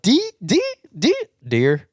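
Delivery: "deer" begun as repeated, high "dee, dee, dee"; Piper. Retold or imitated. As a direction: imitate a child working up to "deer" through high "dee" sounds. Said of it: "That's how she said deer".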